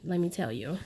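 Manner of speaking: usual speaking voice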